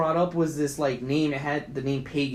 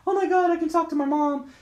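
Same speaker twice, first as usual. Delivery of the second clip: pitched way up and breathless, overjoyed; mock-thrilled gasp; 13 semitones higher than usual. Retelling a horror scene, the daughter gushes in a high excited voice. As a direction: play her giddy at hearing from her dead mom.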